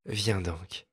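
soft, coaxing invitation to a hiding cat; caressing voice. A narrator's reading. A mistress softly coaxing her frightened cat, caressing. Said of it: "dit-elle d'une voix caressante".

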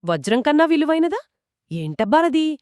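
ordinary delivery

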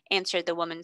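ordinary delivery, nothing marked

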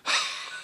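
soft voiceless wheezing breath; demonstrated wheeze. Shown as a demonstration. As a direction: push out a short wheeze as an exercise. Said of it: "then we have wheezing".

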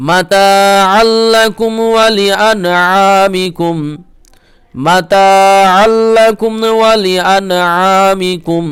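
Quran recitation. Acted. Teacher recites the verse for students in tilawat. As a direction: lift the verse into a full chant.

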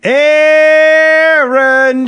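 long, high, loud calling yell. A narrator's reading. Mother calling Aaron from afar, yelling. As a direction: holler the name, stretched out high. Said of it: "she yelled".

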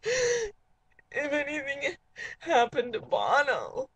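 slow, tearful, laughing through sobs; hammed-up crying. Host fretting about [name], hammed-up teary sobbing. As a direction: break down over the idea of losing him.

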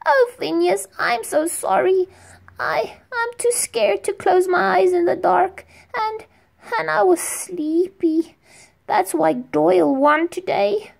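put-on boy apologizing to his firefly friend, near tears; tearful little boy. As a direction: confess like a sorry, scared kid. Boy confesses fear.

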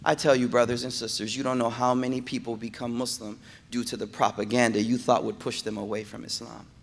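ordinary delivery

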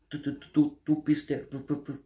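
high, astonished little-bear stutter; stammering. A narrator's reading. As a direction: stutter in awe, stuck on the sounds. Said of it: "stotterte der kleine Bär". (hohes, staunendes Stottern)

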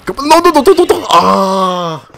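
high, loud panicked shout; being chased. Real, not acted. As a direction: scream as you're caught. Caught and splatted, last push.

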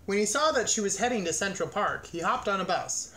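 ordinary delivery

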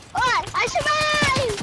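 thrilled high cry of relief; excited. Real, not acted. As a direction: stretch it out, overjoyed. Game survival, high slow cry.

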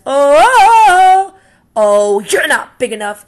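high, very loud yelp, then a nervous brush-off; panicked backpedal. Acted. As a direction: squeal as the gorilla pounds his chest. Ladybug panics at gorilla.

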